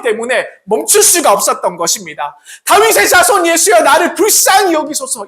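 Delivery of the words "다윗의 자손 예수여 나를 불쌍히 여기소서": high, loud desperate cry. Acted. Bartimaeus crying out to Jesus, loud desperate cry. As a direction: shout for mercy with everything. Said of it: "이렇게 외치며 나갔던 것입니다".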